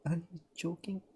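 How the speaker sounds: ordinary talk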